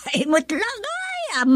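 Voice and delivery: breaking into high, soft giggles mid-word; laughing. Acted. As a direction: laugh through the words, pitch jumping way up. Mother laughs at the story.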